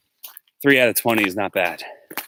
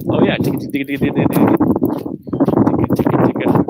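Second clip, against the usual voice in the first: higher, louder, fast "ticka, ticka" clicks, fading to a breathy whisper; mock tonguing demo; 5 semitones higher, 4 dB louder, faster than usual. Shown as a demonstration. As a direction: rattle off ticka like a quick flute tonguing. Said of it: "Wasn't just a".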